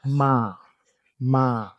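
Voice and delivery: each "Ma" long and level; steady, sustained "Ma"; dragged-out mid tone. Shown as a demonstration. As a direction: drag out "Ma" without bending it. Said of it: "drag it out".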